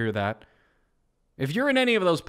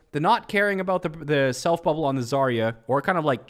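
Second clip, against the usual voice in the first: ordinary talk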